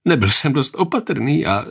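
halting, mildly tearful narrated voice; a touch of distress, breaking off unfinished; tearful confession. A narrator's reading. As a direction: own up as if close to sobbing. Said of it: "znovu se rozvzlikal".

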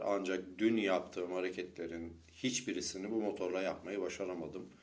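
ordinary delivery

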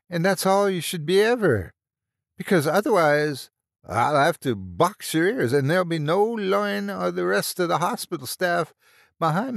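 high, loud Irish tirade; livid anger. A narrator's reading. Nurse cuts the lad off and threatens him, livid. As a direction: tear into the lad in angry Irish. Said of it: "Her green eyes were livid with anger now".

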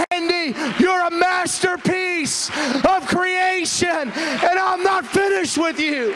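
high, slow shouted proclamation; climactic preaching. Acted. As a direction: proclaim it to the whole congregation, drawing out each phrase. Preaches the climax at full force.

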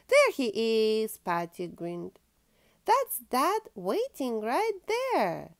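lively storybook reading aloud; reading to children. Acted. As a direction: read it like a bedtime picture book. Reads picture book to child.

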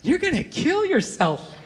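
put-on slow, high child voice; creepy little girl. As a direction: imitate an eerie child's slow pronouncement. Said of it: "she just nods and just goes".

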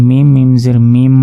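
last "মিম" ends in a long hummed m; ghunna. Shown as a demonstration. Teacher spelling out the syllable, with ghunna. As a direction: hold the closing m of "মিম" through the nose. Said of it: "গুন্না".

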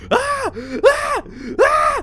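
three very high, loud shrieks; shrill screams. Acted. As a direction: scream again and again in pain. Repeated screams of pain.

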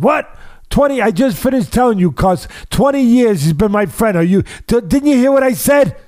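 high-pitched, incredulous outburst; indignant protest. Retold or imitated. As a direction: mimic the hurt friend's indignant disbelief. Upset friend protests to Cus.

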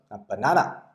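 normal talking voice

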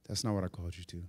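low, hushed reply; quiet divine correction. Acted. The Lord correcting the preacher's flesh, low and quiet. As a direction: drop low and soft, firmly.

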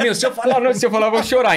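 mock verge-of-tears protest; close to tears. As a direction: act out holding back tears as you speak. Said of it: "Que a voz começa a tremular".

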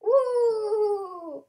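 drawn-out high cheer; excited whoop. Real, not acted. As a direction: whoop it out, sliding gently down. Cheering as water goes in.

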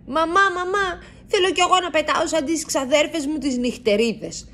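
put-on high, bouncing child's voice begging mom; a little mouse hopping about, begging to fly; excited pleading. A narrator's reading. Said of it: "χοροπηδάει ο Λάκη".

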